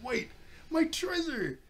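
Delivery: high, slow cry of "my treasure"; mock panic. Nami panics over her treasure, high drawn-out mock cry. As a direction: stretch it out in a mock wail. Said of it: "Nami started freaking out".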